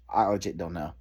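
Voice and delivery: deflated, giving up on the team; exhausted resignation after a sigh; defeated sigh. Real, not acted. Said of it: "I'm just so pissed off".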